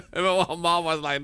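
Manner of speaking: normal talking voice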